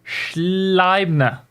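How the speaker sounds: ordinary delivery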